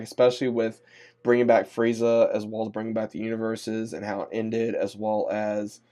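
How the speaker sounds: usual speaking voice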